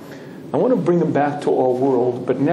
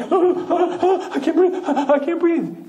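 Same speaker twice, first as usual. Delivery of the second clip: high, laughing mock breathlessness; hammed-up gasping with a laugh; gasping Shmuel; 12 semitones higher than usual. Shmuel at seventy miles an hour, hammed-up gasping. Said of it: "We're gonna hit seventy".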